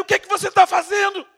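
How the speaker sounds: loud high yell of disbelief; shouting angrily from the wreck; angry shout. Retold or imitated. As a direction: yell it back in anger. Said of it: "o cara gritava pra ele".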